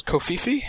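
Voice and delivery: questioning co-fee-fee, rising at the end; covfefe as co-fee-fee. Shown as a demonstration. Host unsure of the word, tries covfefe as co-fee-fee. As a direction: try covfefe with an extra final fee. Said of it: "forget how to pronounce it".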